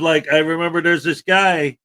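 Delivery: ordinary talk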